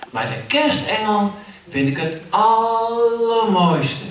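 put-on a small child praising the angel; adoring child. Kindje prijst de engel. (zangerig kinderstemmetje, hoog en traag)

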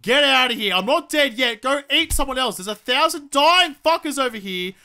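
real angry panic at an attacking bird; yelling at a vulture. Real, not acted. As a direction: shout it off like it's pecking you.

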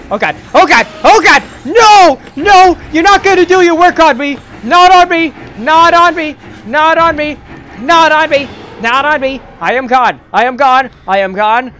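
high, loud panicked cries; terrified screaming. Real, not acted. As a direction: scream in terror while fleeing. Fleeing the monster, screaming.

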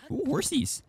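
high delighted squeal; excited. Real, not acted. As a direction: gush over cute horses. Delighted at seeing horses.

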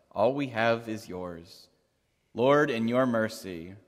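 ordinary talk

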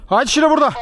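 calling a dog. Real, not acted. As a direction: call the dog over from afar.

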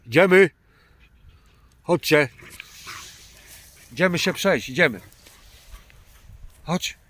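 loud, high call to the dogs; rally the dogs to walk on. Real, not acted. Calling dogs loudly, high.

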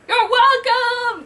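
high, loud, drawn-out call. Acted. Thor calling back to gym employees, drawn-out shout. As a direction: sing it out loudly from far away. Thor calls out after breaking roof.

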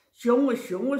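ordinary delivery, nothing marked

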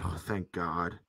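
normal talking voice

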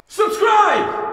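high piercing shout; sudden squealed shout; shouted plug. Acted. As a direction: yell it out like a channel plug.

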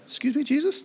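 high, small, hesitant question; a meek interruption in class; timid disciple. Pretend. Disciple timidly interrupting Jesus, high and small. Imagined disciple raises hand.

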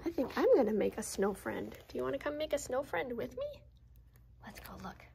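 ordinary delivery

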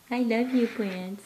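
ordinary talk

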